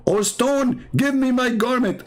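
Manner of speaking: high mock plea to a runaway stone; Moses pleading with stone. Mocking Moses begging the stone for his garment, high pleading. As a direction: whine it up high like pleading with a rock.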